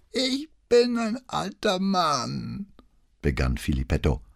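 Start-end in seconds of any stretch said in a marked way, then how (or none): 0.1-2.7 put-on high, slow old man's voice; old notary pleading his age, slow and high; frail old man. A narrator's reading. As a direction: draw it out as an aged man. Old notary begins frailty act.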